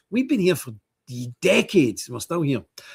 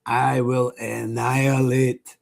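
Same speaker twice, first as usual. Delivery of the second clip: slow, deliberate, heavy vow; measured tough-guy vow, spaced out; impression of friend; much slower than usual. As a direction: intone each threat slowly like a grim fighter. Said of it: "That's quite a good impression of him".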